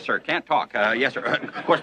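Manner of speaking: normal talking voice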